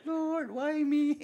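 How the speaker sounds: drawn-out, slow whine; mock whining. Church member whining at home to God, slow mock whine. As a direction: whine it like a self-pitying complainer. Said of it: "magwoling-woling doon sa bahay nyo".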